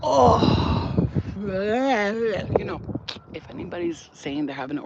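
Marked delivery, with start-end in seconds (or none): 0.0-0.9 pleased moan. Retold or imitated. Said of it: "if he's like".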